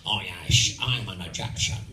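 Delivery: very low, slurred Scottish film-star voice; Sean Connery. Acted. Scottish actor playing an Egyptian, low Connery impression. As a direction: claim to be Egyptian in unchanged Scots. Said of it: "Sean Connery comes on and says".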